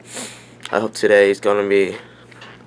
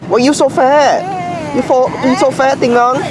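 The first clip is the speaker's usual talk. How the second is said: put-on baby talk; 14 semitones higher, 3 dB louder than usual. Teasing a pet or child playfully.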